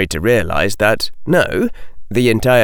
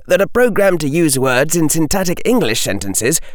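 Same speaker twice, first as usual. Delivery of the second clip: lightly voiced boy, pitched higher; a hint of an earnest, higher boy's voice; young Harry; 7 semitones higher than usual. A narrator's reading. Harry explaining to a witch, lightly voiced higher boy. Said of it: "Harry said".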